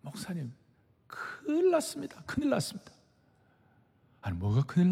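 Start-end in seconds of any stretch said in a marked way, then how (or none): 0.0-2.9 alarmed assistant pastor. Retold or imitated. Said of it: "저한테 쫓아온 거예요".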